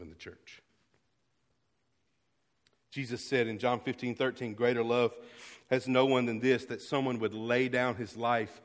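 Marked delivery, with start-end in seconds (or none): 2.9-8.6 full-force preaching. Real, not acted. Pastor preaching agape love to his church, loud and climbing. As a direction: build the verse up to a ringing peak.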